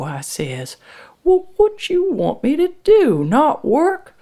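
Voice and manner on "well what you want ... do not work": high, loud indignant question; angry challenge. A narrator's reading. Frank defending his work to his wife, indignant. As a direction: throw it back at your wife, voice way up. Husband argues back.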